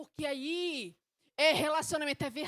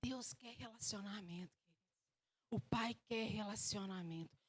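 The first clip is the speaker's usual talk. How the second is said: soft, lowered preaching; hushed earnest appeal; 3 semitones lower, 10 dB quieter than usual. Real, not acted. Preacher telling church God wants relationship, hushed sincerity. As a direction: drop low and let it sink in.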